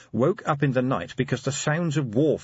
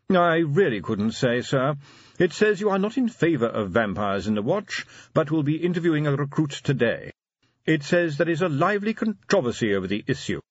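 higher, formal butler voice; unflappable butler, reporting; 7 semitones higher than usual. A narrator's reading. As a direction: read out the news deferentially. Butler reads the paper aloud.

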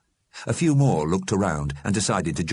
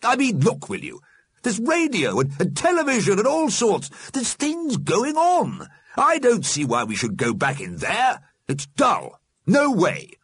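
high, animated arguing; insistent Alderman; 3 dB louder than usual. A narrator's reading. The Alderman refuses to go back, insistent. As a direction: argue excitedly for staying out. Alderman argues against returning.

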